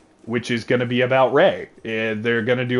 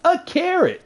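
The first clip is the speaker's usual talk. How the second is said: goofy squeal. Retold or imitated. Silly bit continues.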